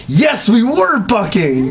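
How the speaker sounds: high, slow, proud filly shout; proud yell. Acted. Filly shouting back at the princess, high proud yell. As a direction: crow it out proudly. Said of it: "foolishly yelled back proudly".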